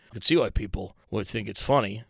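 normal talking voice

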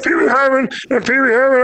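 put-on very high, silly man-child voice; Pee-wee Herman. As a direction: squeak it out as a bad impression. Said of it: "They have to tell you who it is".

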